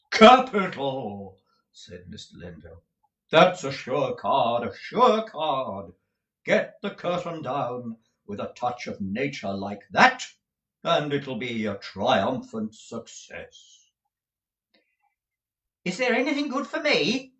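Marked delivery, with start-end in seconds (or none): 0.1-1.4 loud, slow, booming delight; grand exclamation. A narrator's reading. Lenville applauding the ending, loud and slow. 3.3-13.9 slow, theatrical actor's voice; pompous tragedian. A narrator's reading. Lenville admiring Nicholas's room, slow and grand. 15.8-17.3 high, eager worried asking; anxious. A narrator's reading. Folair anxiously asking Nicholas for a role. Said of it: "inquired Mister Folair anxiously".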